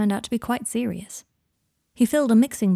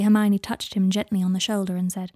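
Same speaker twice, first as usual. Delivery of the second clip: ordinary delivery, nothing marked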